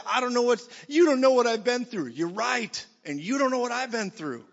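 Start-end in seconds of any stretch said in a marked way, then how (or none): 0.1-2.1 mock hurt protest. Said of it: "You might say".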